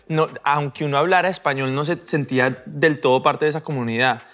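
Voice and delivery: ordinary delivery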